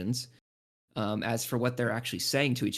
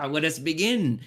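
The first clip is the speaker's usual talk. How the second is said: louder and higher, proud and booming; grand jolly announcement; 5 semitones higher, 6 dB louder than usual. Acted. Cotton Candy Bear grandly leading the way inside. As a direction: declare it like a jolly giant starting an adventure. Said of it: "Cotton Candy Bear is like".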